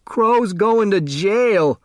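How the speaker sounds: high, slow wail; slow high keening wail. A narrator's reading. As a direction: wail it out in grief, high and drawn. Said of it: "Otis Amber wailed".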